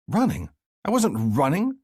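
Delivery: high, wasn't leaned on; indignant denial. A narrator's reading. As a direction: deny it hotly. Defensive denial.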